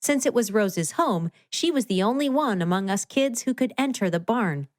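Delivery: usual speaking voice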